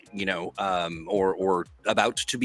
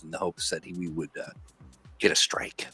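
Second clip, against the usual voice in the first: unvoiced hopeful whisper; 5 semitones lower, 7 dB quieter than usual. Real, not acted. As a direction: breathe it under your breath. Whispered aside.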